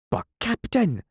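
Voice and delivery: high alarmed pirate protest. Acted. A pirate objecting to his captain's order.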